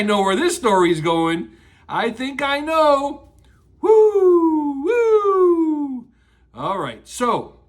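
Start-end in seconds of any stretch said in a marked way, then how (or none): 3.8-6.1 loud high whoop; cheering whoop. Real, not acted. Cheering at story.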